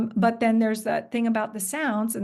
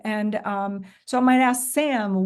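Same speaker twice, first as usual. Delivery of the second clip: normal talking voice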